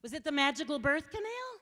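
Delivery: loud sarcastic jab. Retold or imitated. Speaker mocking the petitioner's view, loud and sarcastic. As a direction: ask it with pointed sarcasm.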